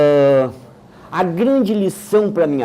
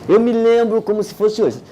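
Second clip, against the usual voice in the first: ordinary talk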